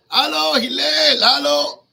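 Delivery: loud impatient call from outside the door. Retold or imitated. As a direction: shout for Hillel to come out. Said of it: "The guy is screaming".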